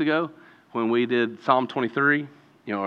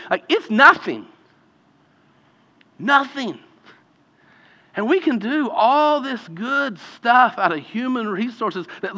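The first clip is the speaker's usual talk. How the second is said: higher, louder impassioned preaching; preaching at full force; 9 semitones higher, 5 dB louder than usual. Acted. Pastor urging the church to rely on Jesus, impassioned. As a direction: lift it into a full pulpit climax.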